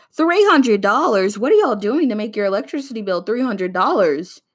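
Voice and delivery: hammed-up shocked mom; mock outrage. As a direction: throw the number back in disbelief. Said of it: "had the audacity to say".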